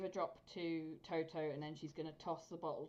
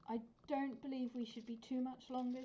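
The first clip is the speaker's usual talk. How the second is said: higher, polite stalling; polite excuse; 7 semitones higher than usual. Acted. Character answering the guards.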